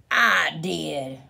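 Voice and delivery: drawn-out, gruff announcement from above; barking villain. A narrator's reading. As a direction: bark it out slowly as the big culprit. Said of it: "barked a voice from above".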